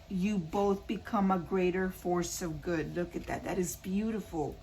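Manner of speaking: ordinary talk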